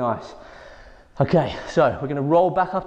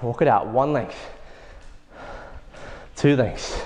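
normal talking voice

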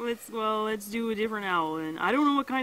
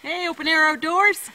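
loud, cheery call-out; shout-out call; 7 semitones higher, 9 dB louder than usual. Real, not acted. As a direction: call it out with a big grin.

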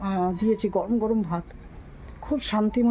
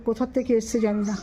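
ordinary delivery

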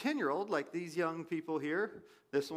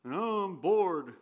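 bored adult; slower than usual. Retold or imitated. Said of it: "and still like".

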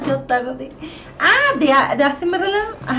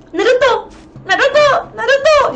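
high, loud calls, rising in alarm; calling the cat; 10 semitones higher, 4 dB louder than usual. Retold or imitated. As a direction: call the limp cat's name, getting more desperate. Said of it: "Y lo agarro y le digo". (llamando al gato, agudo y fuerte, con angustia)

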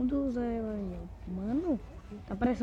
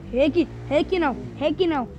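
loud protest; 4 semitones higher, 6 dB louder than usual. Real, not acted. Não quer o personagem Rick.

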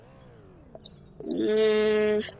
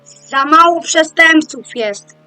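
frustrated; 5 semitones higher, 9 dB louder, much slower than usual. Real, not acted. Frustrated at too few criminals.

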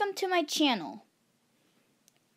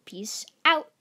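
excited sign-off; 7 semitones higher, 9 dB louder, slower than usual. Real, not acted. Cheery sign-off to viewers.

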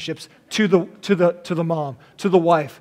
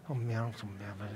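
much lower, much softer mock grumble of a dad; grumpy dad mock; 9 semitones lower, 20 dB quieter than usual. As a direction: grumble like a sulking dad in his recliner. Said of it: "dad could be over there ... going".

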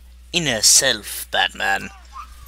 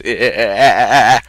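long rattling cackle; cackling; 6 dB louder than usual. Acted. After joking about Batman's house, cackling. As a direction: cackle at your own joke. Laughs at own joke.

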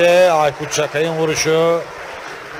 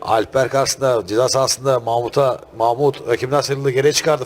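usual speaking voice

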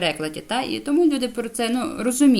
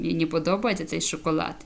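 normal talking voice

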